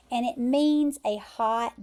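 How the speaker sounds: usual speaking voice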